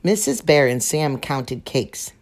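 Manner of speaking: ordinary delivery, nothing marked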